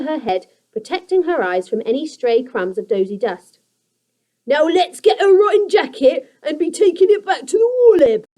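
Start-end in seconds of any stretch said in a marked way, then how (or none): 4.4-8.2 high, loud creature hiss; a greedy goblin hissing orders; hissing creaker. A narrator's reading. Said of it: "hissed Grunt".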